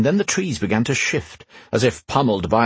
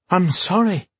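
high-pitched, appalled apology; horrified apology; 10 semitones higher than usual. A narrator's reading. Queen to the knight she upset, horrified. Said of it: "horrified by the reaction she'd gotten".

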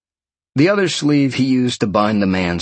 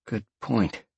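murmured; 8 dB quieter than usual. Acted. Sean quietly agreeing with his inner lion, a soft murmur. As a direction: barely voice it, just for yourself. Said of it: "Sean murmured".

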